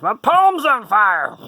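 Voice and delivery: high, loud, slow cry of a little lady; mock shriek. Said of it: "and she says".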